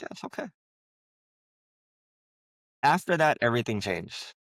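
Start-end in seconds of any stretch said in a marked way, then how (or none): 0.0-0.5 hushed answer. Retold or imitated. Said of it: "It's like".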